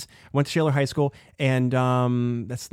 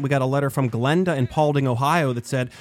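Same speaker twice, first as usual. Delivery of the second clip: normal talking voice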